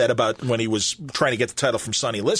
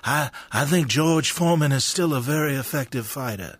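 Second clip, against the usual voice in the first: a hint of older Ali, unhurried and hushed; Muhammad Ali impression; 2 semitones higher than usual. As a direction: imitate Ali's halting late-life speech gently. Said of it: "he would talk like this".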